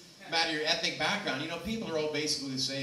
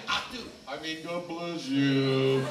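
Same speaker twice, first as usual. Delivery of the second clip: very slow, loud mock German; hammed-up German blessing; fake German; 5 dB louder, much slower than usual. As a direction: stretch it out like a German pope. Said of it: "German's a good one to fake".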